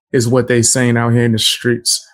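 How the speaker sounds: ordinary talk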